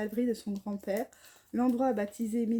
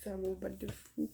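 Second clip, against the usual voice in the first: aparté murmuré; 2 semitones lower, 10 dB quieter than usual. Real, not acted. Répétition murmurée pour soi.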